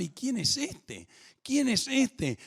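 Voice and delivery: high, wondering bystander asking who he is; a hint of a puzzled onlooker, rising; voicing the puzzled crowd in Jerusalem, wondering aloud; curious crowd voice. Crowd voiced wondering about Jesus.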